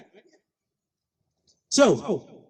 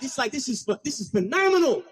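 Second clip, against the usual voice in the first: normal talking voice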